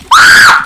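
very high, loud scream; startled shriek. Pretend. Player spotting Void Armor, sudden high shriek.

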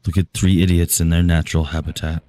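nature documentary. Pretend. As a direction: narrate like a nature show. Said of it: "On today's National Idiotic".

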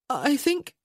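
high, halting, choked start; choking on words. A narrator's reading. Woman leaving after a fright, choking up. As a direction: start high and catch on the word. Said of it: "choking on the word".

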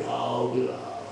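mock ghost voice, low and ominous; ghost through cloth. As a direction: play a low ghost to scare children. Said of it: "Pressing her face against the sari".

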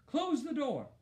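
yell. A narrator's reading. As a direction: yell it across the house. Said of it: "and Joey all yelled".

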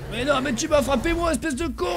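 high-pitched indignant outburst; outraged gamer squawk, rising; indignant protest. Real, not acted. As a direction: protest being hit, voice climbing high. Protests being hit by player.

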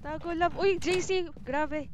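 loud, high, frantic urging; yelling in a panic to hide; panicked. Real, not acted. Panicked hiding from ghost.

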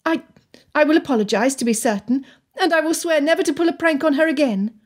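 high-pitched Anne making promises; earnest young Anne. Acted. Anne makes promises to uncle.

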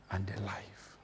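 whispered, soft and unvoiced. Real, not acted. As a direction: breathe the words as a secret. Sermon phrase dropped to whisper.

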